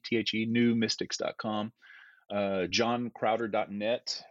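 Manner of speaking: normal talking voice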